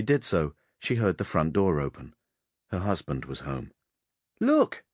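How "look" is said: put-on high, loud child's cry; sharp, high boyish exclamation; excited boy. A narrator's reading. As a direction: call out like a little boy showing his mother. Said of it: "The boy pointed".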